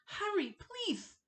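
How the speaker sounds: high, slow, desperate begging; high panicked plea. Acted. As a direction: beg with rising panic. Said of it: "his voice high and panicked".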